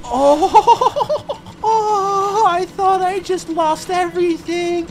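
high, loud laughing through the words; relieved laughter. Real, not acted. Gamer laughing in relief at finding a checkpoint.

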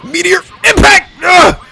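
loud, high battle call; hype shout. Real, not acted. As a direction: shout the move name. Calling special attack.

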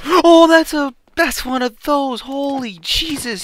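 high startled gasping exclaim; high frightened surprise. Real, not acted. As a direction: jump with real fright. Startled by sudden enemy.

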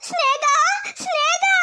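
high, wailing cry over her dead snake; wailing grief. Acted. Snake charmer wailing over her dead snake, high and grieving. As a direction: wail her name, heartbroken.

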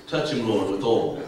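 the timid prayer he skipped; gentle prayer. Retold or imitated. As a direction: pray it tenderly, under the breath. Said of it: "I didn't do this".

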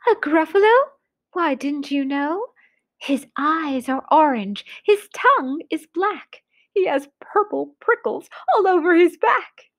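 lively little storybook mouse. Acted. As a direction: lift the voice into a small mouse.

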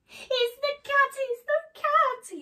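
very high, loud mouse squeal; panicked cry. Acted. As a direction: cry out in exaggerated storybook terror. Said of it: "he cried".

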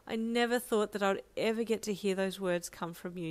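ordinary delivery

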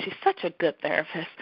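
ordinary delivery

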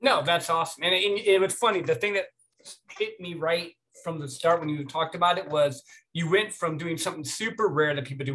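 ordinary talk, nothing marked

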